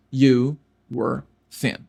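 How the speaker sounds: You were thin bem lento e articulado; devagar. Shown as a demonstration. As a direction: separe cada palavra com calma. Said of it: "Como eu digo, você era magro". (You were thin, lento, para repetir)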